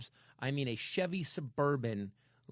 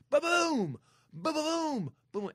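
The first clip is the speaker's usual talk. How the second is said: loud, high booming crash noises; crash boom sounds; 8 dB louder, slower than usual. Retold or imitated. Telling a friend about the crash, loud boom noises. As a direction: smash out each impact of the rolling car. Imitating the car flipping.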